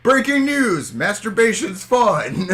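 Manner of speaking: high, slow, dramatic headline read; mock news anchor. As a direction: announce it like breaking news.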